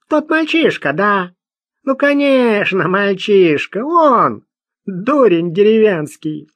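высокий, громкий гнусавый бродяга; возмущённо отпираться наглым бродягой; возмущённый бродяга. Acted. Персонаж бродяга. (гнусавый бродяга отпирается перед Луи)

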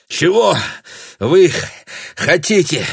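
выдыхает, запыхавшись; запыхался после лестницы; out of breath. A narrator's reading. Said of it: "выдохнул Шенди". (запыхавшийся голос)

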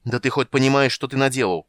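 heated accusing question; angry reproach. A narrator's reading. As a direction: berate him without waiting for an answer. Tanis angrily scolds the old wizard.

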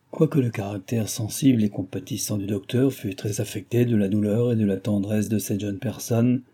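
ordinary delivery, nothing marked

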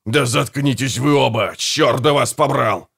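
understated exasperated shouting; a touch of bellowing; mild angry yell. A narrator's reading. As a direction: tell them both off crossly. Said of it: "завопил Гегель".

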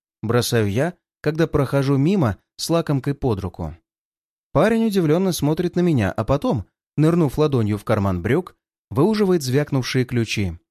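ordinary delivery, nothing marked

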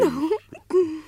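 high, sly fox cackle. Acted. Reineke lacht verschlagen. (verschlagenes hohes Kichern)

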